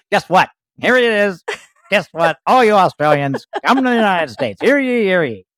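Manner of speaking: high, loud public proclamation; town crier. Pretend. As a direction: announce it to the whole town square. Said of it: "I'm ringing the bell".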